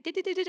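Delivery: high, quick mock door-closing beeps; a playful imitation of the closing-doors alarm; imitated door beeps. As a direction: chirp it like the tube doors warning. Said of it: "that noise started".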